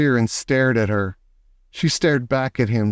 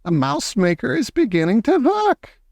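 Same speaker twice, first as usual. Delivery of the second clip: excited witch; 9 semitones higher than usual. Acted. Witch gloating.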